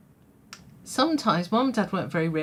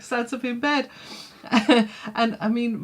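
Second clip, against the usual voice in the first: normal talking voice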